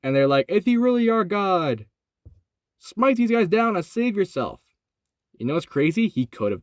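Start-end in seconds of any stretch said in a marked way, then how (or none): 0.5-4.6 mocking. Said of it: "they are mocking him".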